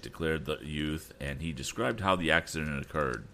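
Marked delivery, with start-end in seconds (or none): none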